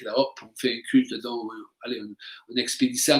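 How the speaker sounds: low, quick, offhand mock haste; mécanique, expédié. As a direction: rattle it off softly, just getting it over with. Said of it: "mécanique". (ton expédié, bas et rapide)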